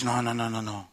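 low, soft string of noes; calm restraint. Retold or imitated. God stopping the comedian's outburst, low and quiet. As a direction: gently talk him down, dropping low.

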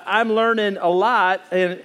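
ordinary delivery

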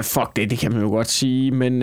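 ordinary delivery

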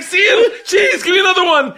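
very high, loud, giddy yelling; excited shout. Retold or imitated. Behind the bar, yelling to Igor for another word, very high and loud. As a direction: yell eagerly for the next word. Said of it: "I was like".

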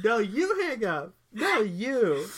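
high, loud, drawn-out sing-song teasing; childish lovey teasing. Acted. Host mocks lovers refusing to hang up, childishly teasing. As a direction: stretch it out like a mock lovesick kid. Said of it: "They're acting like ten-year-olds".

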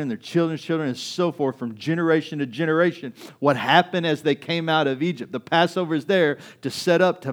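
loud, high, emphatic sermon delivery; full-force preaching. Real, not acted. Preacher urging people to remember the tradition, full-force preaching.

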